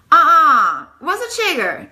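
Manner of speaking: loud, high mock-shocked objection; incredulous women. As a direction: imitate two women balking, swooping high. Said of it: "Just like that".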